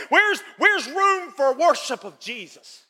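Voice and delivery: slow, ringing preacher's cry; preaching shout. Real, not acted. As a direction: plead it out slowly to the congregation. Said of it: "preacher's rising appeal".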